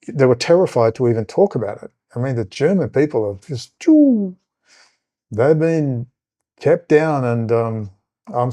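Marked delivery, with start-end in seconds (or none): 3.8-4.4 sharp exclaimed noise. Real, not acted. Wordless burst mid-sentence.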